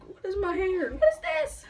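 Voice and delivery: rising sharply into a high, loud what is this; startled at her hair. Real, not acted. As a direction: caught off guard mid-sentence by her own hair. Suddenly notices her hair.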